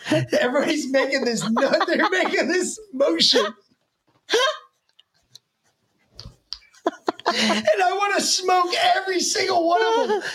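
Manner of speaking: high, squeezed-out laughing delivery; cracking up. Real, not acted. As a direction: struggle to talk through real laughter. Cracks up recalling the mocking motion.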